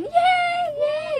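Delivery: high, loud mock cheer; retold happy shout; excited yay. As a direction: squeal with joy. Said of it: "sigawan kami doon".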